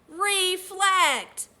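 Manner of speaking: loud, high, clearly spoken model word; leading the class. Shown as a demonstration. Teacher leading children to say the new word, loud. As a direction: say "Reflect." loud for the class to echo. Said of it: "Everyone say it".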